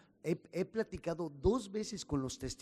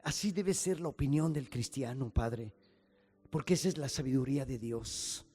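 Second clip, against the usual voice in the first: louder, rising pastor's prayer building in force; fervent prayer; 5 dB louder than usual. Acted. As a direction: pray aloud to the Father with growing fervour. Pastor leads closing prayer.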